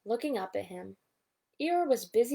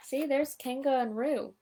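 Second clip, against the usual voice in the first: ordinary delivery, nothing marked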